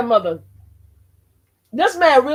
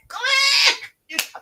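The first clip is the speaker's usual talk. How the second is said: excited shout. Retold or imitated. She shouts how she instantly subscribed to the streamer. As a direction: shout it as you hit the button.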